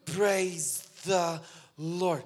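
"Praise the Lord" drawn out very slowly; imitating the girl's effort. Pastor mimics the girl slowly forming her first phrase. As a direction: mimic the girl's slow struggling attempt. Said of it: "Can you say, "Praise the Lord"?".

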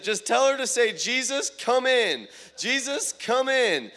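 high, loud prayer call; fervent urging. Retold or imitated. Preacher telling the translator what she should pray, fervent urging. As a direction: cry it out with full-voiced excitement.

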